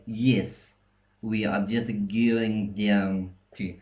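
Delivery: mock Russian accent, drawn out with y-glides; bad Russian accent. Shown as a demonstration. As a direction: fake a Russian accent by palatalizing every word. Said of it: "they would say".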